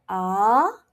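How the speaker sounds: AH sliding upward; rising tone on AH, like a question; second tone. Shown as a demonstration. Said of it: "It has to go up because it's a rising tone".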